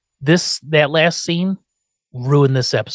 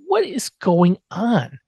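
slow bewildered; 5 semitones higher, much slower than usual. Retold or imitated. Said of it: "I'm like".

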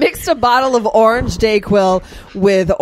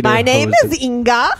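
hammed-up Swedish movie accent; mock Swedish accent; 3 semitones higher than usual. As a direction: introduce yourself as Inga in a joke Swedish accent. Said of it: "you know who I sound like".

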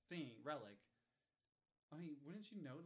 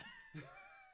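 high laugh; 5 dB quieter than usual. Real, not acted. After retelling the teacher story.

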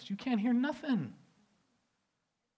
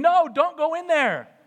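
screaming at screen; 8 semitones higher, 9 dB louder, slower than usual. Retold or imitated. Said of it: "screaming at the screen".

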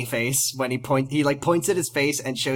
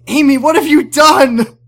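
high, very loud mock-scandalised yell; hammed-up outraged scream at a daughter; horrified shout; 11 semitones higher, 10 dB louder than usual. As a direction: shout it like an appalled mother. Acting mother finding Ben collapsed.

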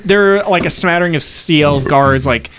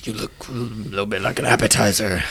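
dragon voice; 5 semitones lower, 8 dB quieter than usual. Acted. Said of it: "He says".

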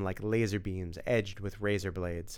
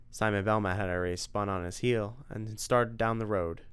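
plain reading voice

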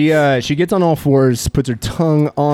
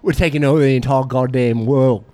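swagger as a wasteland boss with a wobbly Australian twang; a hint of an Australian accent; British-Australian accent; 7 semitones higher than usual. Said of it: "You sounded British at the start".

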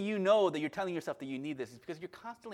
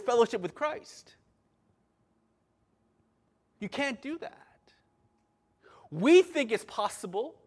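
forceful, raised-voice preaching, punching key words; worked-up pulpit yelling; yelling preacher; 3 semitones higher, 5 dB louder than usual. Real, not acted. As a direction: preach it loud and urgent, hammering the point home. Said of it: "Why am I yelling".

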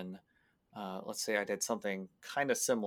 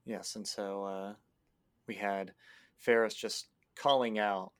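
ordinary delivery

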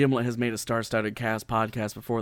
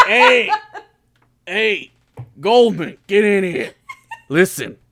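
loud, brash exec barking orders; a blustering boss calling someone in; pushy studio boss; 4 semitones higher, 8 dB louder than usual. Pretend. Acted loud studio boss scene.

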